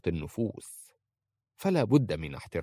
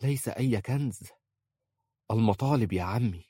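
hesitant; 2 semitones higher than usual. A narrator's reading. Aisha revealing the treasure to the sheikh, hesitantly. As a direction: offer it reluctantly, a touch unsure. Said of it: "قالت في تردد".

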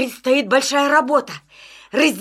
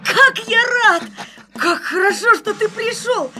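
high, delighted and glad; joyful greeting; 7 semitones higher than usual. Acted. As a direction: greet an old friend happily. Joyful reunion with wolf. (радостное приветствие)

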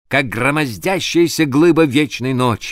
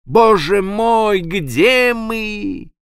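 high, slow despairing moan; first mate moaning beside the captain; 8 semitones higher, much slower than usual. A narrator's reading. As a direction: groan it out in dread. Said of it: "простонал возле меня старший помощник".

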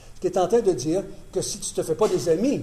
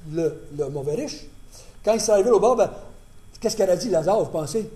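ordinary delivery

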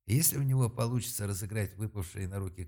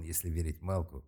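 ordinary delivery

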